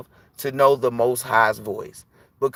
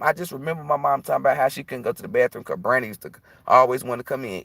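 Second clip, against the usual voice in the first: ordinary delivery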